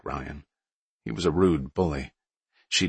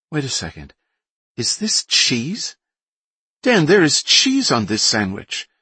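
put-on Boston accent; 9 semitones higher, 5 dB louder than usual. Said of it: "she said in a thick Boston accent".

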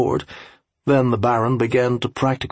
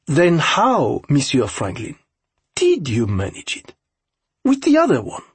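higher-pitched, incredulous aide voice; French aide, challenging; 6 semitones higher than usual. A narrator's reading. Aide asking Franklin how he managed before, challenging. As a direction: press the question as an accented interpreter.

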